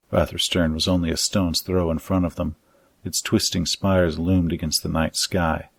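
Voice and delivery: ordinary delivery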